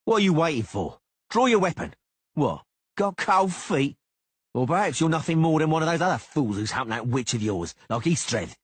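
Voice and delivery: mock his courage loudly; furious taunting duelist; taunting shout. A narrator's reading. Cicada taunts Geralt.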